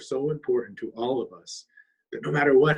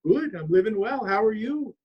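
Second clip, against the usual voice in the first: lightly voiced bird, higher and courteous; a touch of a gentle, higher bluebird; polite bluebird; 8 semitones higher than usual. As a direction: answer sweetly like a polite little bird. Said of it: "was polite and said".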